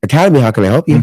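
lightly put-on receptionist greeting; mock customer-service voice; professional phone voice. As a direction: switch abruptly into polite business mode. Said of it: "like, professional voice on".

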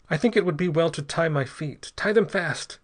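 high, halting desperate instructions; gasping. A narrator's reading. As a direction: force it out in broken, urgent bursts. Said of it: "gasped Ortiz".

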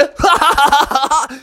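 high, long taunting cackle; mocking laugh. Employees laughing at Junior, put-on mocking cackle. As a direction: cackle at him.

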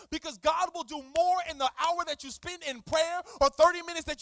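loud, driving sermon delivery; preaching. Acted. Pastor challenging listeners to pray, loud preaching. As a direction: push the congregation hard.

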